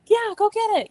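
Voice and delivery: high-pitched retold cheer; eager, high sideline encouragement, retold. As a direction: imitate a kid cheering teammates on. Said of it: "he'd cheer them on".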